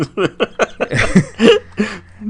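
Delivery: loud, high burst of laughter; long genuine laugh; real laughing fit. Real, not acted. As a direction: crack up helplessly, high and loud. Cracking up at own smelly description.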